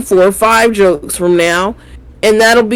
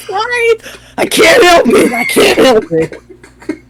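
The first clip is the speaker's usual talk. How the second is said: high, breaking laugh-cry; can't hold it together; 10 semitones higher than usual. Pretend. Mocking a contestant cracking up on stage, laughing.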